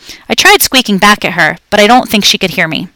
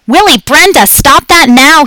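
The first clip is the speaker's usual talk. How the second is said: higher, louder, slow yell; yelling up the stairs; 7 semitones higher, 5 dB louder than usual. A narrator's reading. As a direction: call up the stairs to the kids. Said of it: "he yelled".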